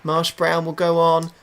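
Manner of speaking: normal talking voice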